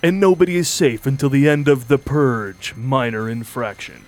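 ominous trailer announcer; horror trailer voice. Acted. Trailer narration.

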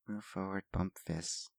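hushed, very soft prompt; slight whisper. Acted. Lorilla quietly prompts the stone guardians, in a slight whisper. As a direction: whisper the command under your breath. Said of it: "You hear in a slight whisper".

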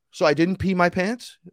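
bewildered question. Acted. Tripper to his helper after the demon left, bewildered question. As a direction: imitate someone sheepishly checking.

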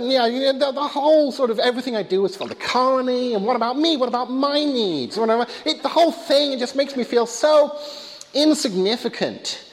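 put-on high-pitched neurotic ant, complaining in American accent; Woody Allen impression. Ant complains to his therapist, high neurotic impression. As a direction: whine your grievances high, slowing on the last word. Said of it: "Woody Allen plays this neurotic ant".

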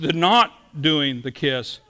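normal talking voice

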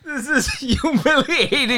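high, drawn-out mock lament; slow, high-pitched wounded protest; mock humiliation. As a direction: whine in comic shame, pitched high. Mock-offended Indian voice, amid laughter.

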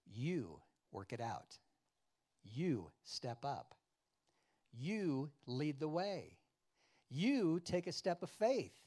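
slow commands, "You" stretched and stressed each time; emphatic YOU. Retold or imitated. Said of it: "meaning you with emphasis".

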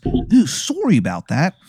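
apologetic, swooping mock Canadian accent; Angel of Canada, Canadian accent. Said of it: "it is a horrible Canadian accent".

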